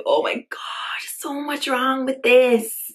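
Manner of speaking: hushed, slow whisper of disbelief; shocked whisper. Real, not acted. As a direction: breathe it out, appalled. Stunned reaction to the headline.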